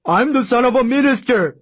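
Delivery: high, loud, desperate yell; screamed. A narrator's reading. Intern dragged toward the shop protests his status, screaming. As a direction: cry out as if being dragged off. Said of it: "Jujak screamed".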